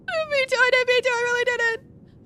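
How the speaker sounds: high, pleading voice near tears; desperate denial. Acted. [name] pleads with Wiki, high and desperate. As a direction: insist in a panic you meant no harm.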